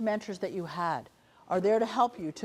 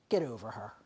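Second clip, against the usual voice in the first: low, breathy whisper close to the ear; a doctor's quiet aside at the bedside; hushed whisper; 9 semitones lower, 5 dB quieter than usual. Retold or imitated. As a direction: whisper it so only she hears. Doctor squeezes arm, whispers reassurance.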